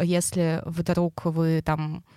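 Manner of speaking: ordinary talk, nothing marked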